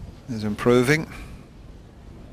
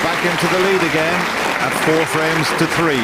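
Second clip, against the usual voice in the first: raised, higher call of the moment; excited commentary; 8 semitones higher, 4 dB louder than usual. Real, not acted. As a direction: lift it for the big moment. Celebrating century, excited call.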